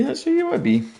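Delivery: mock squeaky repeat. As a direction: squeak the repeat as a sarcastic jab. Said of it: "And I was just like".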